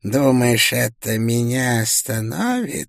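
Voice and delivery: eerie mocking spirit voice; ghostly voice. A narrator's reading. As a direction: taunt like a dead soul. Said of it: "сказала душа". (потусторонний насмешливый голос)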